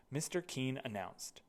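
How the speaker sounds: plain reading voice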